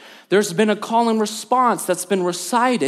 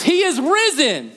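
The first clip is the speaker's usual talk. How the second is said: high, ringing, jubilant call; fervent shout; 9 semitones higher than usual. Shown as a demonstration. Pastor urging the congregation, jubilant shout. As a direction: shout it like a victory. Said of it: "Say it like he died for your sins".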